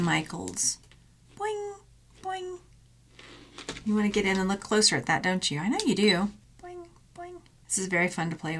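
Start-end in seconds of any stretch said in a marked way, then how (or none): 1.4-2.6 high, soft cartoon boing; springy boing sound. Boing noise while playing with a springy piece. 6.6-7.4 high, soft cartoon boing; springy boing sound. Boing noise while playing with a springy piece.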